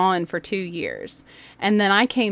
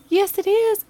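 much higher imitated mom voice; mom on the phone; 13 semitones higher, 4 dB louder than usual. Kyle's mom answering the teacher's call, high voice.

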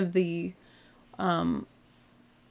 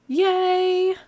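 high, bright yay; excited cheer; 11 semitones higher, 4 dB louder than usual. Real, not acted. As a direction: cheer it out loud. Cheering her first producer.

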